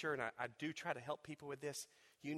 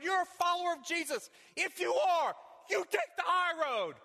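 high, loud preaching voice; fervent preaching; 11 semitones higher, 7 dB louder than usual. Acted. Preacher picturing the other wounded person, fervent preaching. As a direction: preach it out, pleading.

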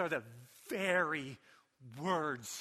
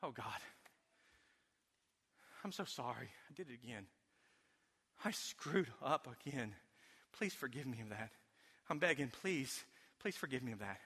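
soft, pleading confession to God; softer imitated guilty prayer; remorseful begging prayer; 5 semitones lower, 8 dB quieter than usual. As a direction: plead softly like someone ashamed. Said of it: "prayed a prayer something like this".

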